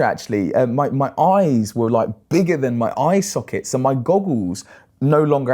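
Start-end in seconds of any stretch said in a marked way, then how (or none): none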